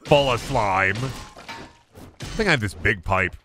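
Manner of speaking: usual speaking voice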